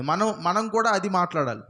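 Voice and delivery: ordinary talk, nothing marked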